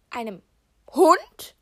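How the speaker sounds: high, very loud surprised yelp; the last surprise tips her over, shriek it; shocked squeal. Real, not acted. Hears about the puppy.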